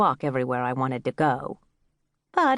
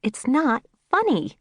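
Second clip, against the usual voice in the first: higher-pitched scolding, voiced hiss; understated cross hiss; mild hiss; 8 semitones higher than usual. A narrator's reading. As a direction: hiss a mild rebuke at a pet. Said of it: "I hissed".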